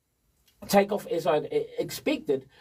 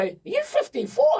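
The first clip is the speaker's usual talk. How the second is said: imitating people's amazed question, much higher; 8 semitones higher than usual. People reacting to his age, high and amazed. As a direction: ask it like you can't believe his age. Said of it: "a lot of people go".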